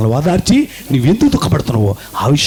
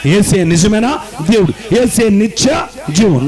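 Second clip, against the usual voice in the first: slow, higher proclaiming over music; preaching; 7 semitones higher, slower than usual. Acted. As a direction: declare it slowly to the hall. (నెమ్మదిగా ప్రకటించే బోధ)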